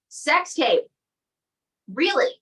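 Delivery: shocked high question; surprised. Real, not acted. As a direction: gasp out the question. Host shocked at puppet's answer.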